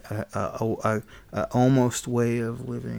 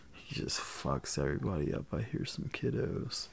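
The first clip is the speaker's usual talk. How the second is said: whispering; 7 semitones lower, 15 dB quieter than usual. Real, not acted. Hearing children nearby on mic.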